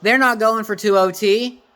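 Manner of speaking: high, loud fired-up rant; excited shouting. Real, not acted. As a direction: shout it, thrilled and vindicated. Fan thrilled team goes for win.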